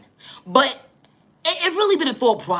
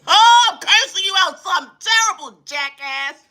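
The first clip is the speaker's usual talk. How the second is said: mock cursing out; 7 semitones higher, 4 dB louder than usual. Comic rant cursing someone out.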